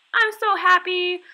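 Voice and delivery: exaggerated joy. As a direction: overdo the happiness for kids. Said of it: "I'll sometimes be like".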